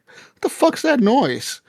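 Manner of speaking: high-pitched, drawn-out baffled question; retold bewilderment. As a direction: act out puzzlement at a strange sound. Retold confusion at an unexpected voice.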